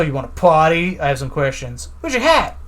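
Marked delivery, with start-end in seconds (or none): 2.0-2.6 higher mock scold. Repeat of the line, pitched high.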